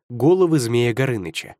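ordinary delivery

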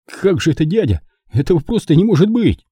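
high, anguished disbelief; distraught, high-pitched refusal to believe; moaning dismay. A narrator's reading. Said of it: "простонал".

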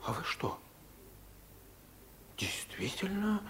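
conspiratorial whisper. Acted. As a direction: lean in and whisper it. Conspiratorial question about fleeing.

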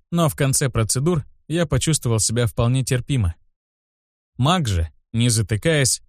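plain reading voice

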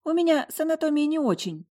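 put-on awkward girl confessing. A narrator's reading. Roommate admits confusion.

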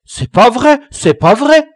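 high, loud, repeated denial; shrill protest. Acted. The accused boy protests to the teacher, high and loud. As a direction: deny it hotly, like a cornered boy. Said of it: "protesta l'accusé".

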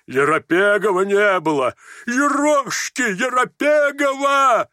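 loud, high, drawn-out shout; frenzied cry. A narrator's reading. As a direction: bellow it, rising and stretching the name. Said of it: "вскричал он в выступлении". (исступлённый протяжный крик)

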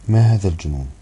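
loud indignant outburst; near-shout. A narrator's reading. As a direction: exclaim it loudly, short of a scream. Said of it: "وارتفع صوتي كأني أصرخ".

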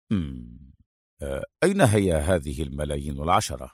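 lightly voiced low hero, measured explanation; Adham, composed deducer. Adham explains the kidnappers' plan to his foe, composed and low. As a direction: lay out the scheme in a composed low voice.

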